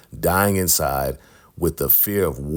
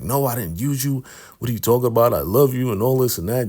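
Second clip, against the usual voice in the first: pleading protest; 6 semitones higher, faster than usual. Retold or imitated. River protests he loves Peter.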